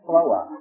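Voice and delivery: Floa in two beats, no R; southern Georgia pronunciation. Shown as a demonstration. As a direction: drop the R entirely: Floa. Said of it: "No R in the word".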